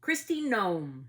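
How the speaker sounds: drawn out like an answer found; slow, emphatic reveal of the Homeland Security director; announcing the name. Real, not acted. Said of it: "Who is the director of Homeland Security?".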